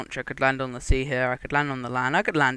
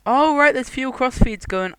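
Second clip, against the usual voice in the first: sudden realisation; 9 semitones higher, 7 dB louder than usual. Real, not acted. Sudden realisation about fuel.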